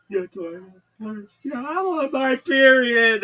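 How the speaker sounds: high, loud, drawn-out whine; whiny caller. Retold or imitated. Caller whining to Delilah, loud and slow. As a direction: stretch the complaint out loud.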